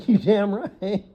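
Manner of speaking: high, loud, cocky boast; cocky retort. Pretend. Answering the embarrassed bride, a loud cocky retort. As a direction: own it proudly and loud. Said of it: "and be like".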